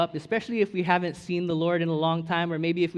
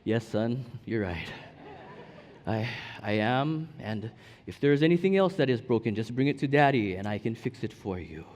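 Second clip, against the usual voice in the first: proud dad; 4 semitones lower than usual. Retold or imitated. Father voices himself answering his admiring son.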